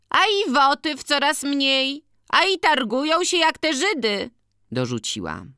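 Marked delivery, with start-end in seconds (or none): 0.1-4.3 very high, loud matronly complaint; organist's wife, indignant. Acted. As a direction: push the voice way up into a loud grievance. (bardzo wysoki, głośny głos narzekającej organiściny)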